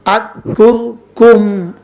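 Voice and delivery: আযকুরকুম in slow, separate beats, at-kur-kum; split into syllables. Shown as a demonstration. Preacher breaking আযকুরকুম down for ease, syllable by syllable. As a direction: pause between the syllables of আযকুরকুম. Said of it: "সহজের জন্য আমি syllable ভাগ করে বলছি".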